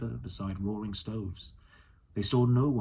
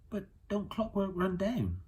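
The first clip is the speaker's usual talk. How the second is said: a hint of a curious girl, asking; a girl pressing the old man for answers; lightly voiced young girl; 10 semitones higher than usual. A narrator's reading. Lyra asks.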